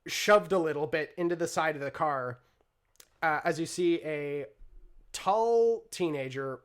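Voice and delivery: usual speaking voice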